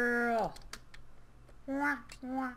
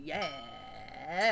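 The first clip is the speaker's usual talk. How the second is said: slurred low pirate growl; lightly voiced drunk pirate; 6 semitones lower, 5 dB quieter than usual. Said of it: "like a drunk pirate".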